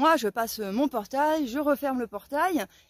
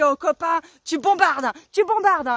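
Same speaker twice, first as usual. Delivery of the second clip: louder eager egging on; excited cheering; 4 semitones higher, 6 dB louder than usual. Retold or imitated. Cheering the neighbour as he speeds after the van. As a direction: egg the driver on with glee. Cheering driver in car chase.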